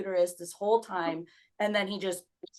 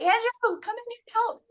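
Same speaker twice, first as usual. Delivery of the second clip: very high retold cry for help; calling for help; 5 dB louder than usual. Woman in labor calling her husband in, high urgent shout. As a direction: act out calling urgently to another room.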